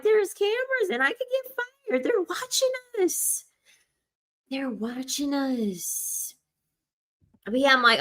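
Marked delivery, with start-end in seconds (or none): 0.0-3.4 very high, squeaky warning; mock alarm. Acts out warning about cameras. 4.5-6.3 slow, soft, raised-pitch warning; mock warning. Repeats warning, slower and softer.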